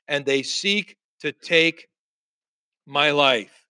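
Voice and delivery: slow, with pauses before the end; halting, weighty delivery; deliberate lament. Acted. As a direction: draw it out, pause before "my life". Elijah's lament, spaced out.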